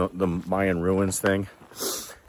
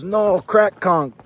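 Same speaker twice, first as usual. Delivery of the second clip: a higher, slowed-down correction from the lady; laughing lady; 9 semitones higher, 3 dB louder, much slower than usual. Retold or imitated. The lady laughing and correcting him, higher and slow. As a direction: drawl it out like a patient correction. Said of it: "She goes".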